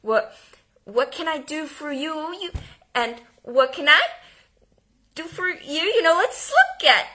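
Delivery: lightly voiced nervous dentist, voice cracking; mildly cracking, uncertain greeting. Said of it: "cracking up her voice the whole time".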